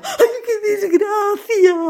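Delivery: high, slow sobbing wail; crying mother. A narrator's reading. Mother lamenting to the family, high slow wailing. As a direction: draw out the grief in tears. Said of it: "la madre decía".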